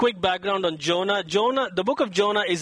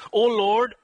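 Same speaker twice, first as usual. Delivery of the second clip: Jonah's indignant tone; 4 semitones higher than usual. Acted. Prophet protesting to God, indignant. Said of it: "you have to hear his tone".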